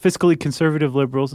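ordinary delivery

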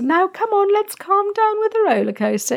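high cajoling parent voice; mock sing-song parent. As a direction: coax a melting-down child in a high sing-song. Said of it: "the time to say".

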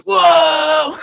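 long, high-pitched mock "whoa"; imitated movie voice. Imitating Ned flying back, high drawn-out whoa. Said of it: "you hear him with his voice".

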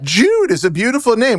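high delighted praise; gushing. Real, not acted. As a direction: coo over the name. Gushing over baby's name.

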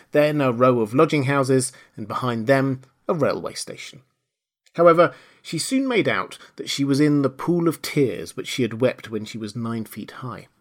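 usual speaking voice

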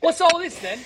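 high-pitched mock Cockney; Cockney accent. Shown as a demonstration. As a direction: put on a jokey Cockney accent. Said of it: "like, the Cockney accent".

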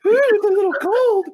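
high falsetto, mock-startled by the cold; hammed-up high squeal; mock shiver. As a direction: squeak it out like cold water hit you. Mocking cop on bidet.

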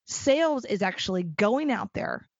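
ordinary talk, nothing marked

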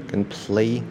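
slow pray and play, R set against L; distinct R and L. Shown as a demonstration. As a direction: keep the R in pray and L in play apart. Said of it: "第一个就是pay".

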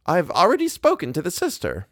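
high-pitched, self-important announcement. A narrator's reading. Wife reporting she phoned the sister, self-important. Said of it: "Missus Montague said importantly".

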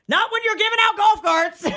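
high squealing laugh through words. Real, not acted. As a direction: crack up while talking. Laughing at own joke.

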